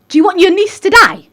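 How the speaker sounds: high, loud angry shout; retold shout. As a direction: shout at her to make her act. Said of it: "She shouted at her".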